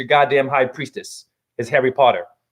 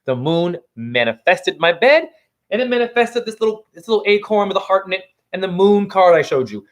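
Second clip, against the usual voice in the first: normal talking voice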